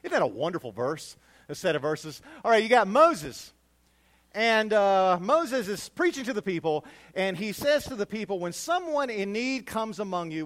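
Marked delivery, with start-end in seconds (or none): 2.4-8.4 loud, high preacher's call to the flock; full-force preaching. Acted. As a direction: preach it out to the congregation. Pastor preaching loudly to congregation.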